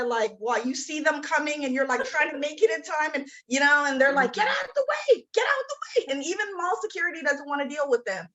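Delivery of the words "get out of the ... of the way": much higher, urgent cry to move; high-pitched urgent call. Acted. Shopper trying to get to the store in time, high urgent call. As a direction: call out high, as if clearing a path. Acted panicked call to clear path.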